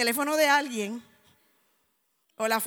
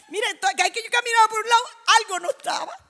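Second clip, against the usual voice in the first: fast, higher rush of words; 6 semitones higher, 4 dB louder, faster than usual. Real, not acted. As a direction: blurt it out quickly. Excited retelling. (narración rápida y agitada)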